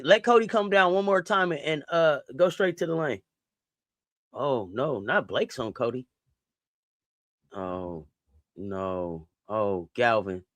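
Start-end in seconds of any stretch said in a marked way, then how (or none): none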